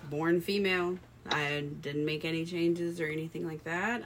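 ordinary talk

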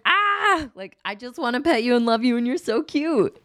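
high, gushing coo over a cute pet; gushing at the dog. Retold or imitated. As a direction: pour out love on the dog, way up high. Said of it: "And you're like".

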